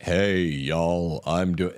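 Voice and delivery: mock a politician faking a Southern man's twang; mock Southern drawl. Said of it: "the Southern male accent".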